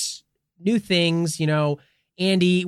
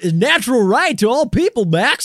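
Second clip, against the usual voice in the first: mock Goofy lecture; 7 semitones higher, 3 dB louder than usual.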